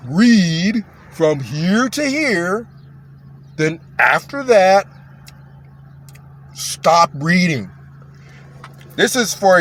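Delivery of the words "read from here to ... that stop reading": very slow step-by-step instruction, long pauses; exaggerated, over-careful talking down; Stephen Lett. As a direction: stretch each word as if they won't follow. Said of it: "That's Stephen Lett".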